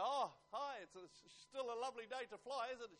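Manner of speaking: loud, high, chirpy traveler; acted bubbly small talk; cheerful greeting. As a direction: greet the check-in lady brightly. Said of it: "being cheerful and say".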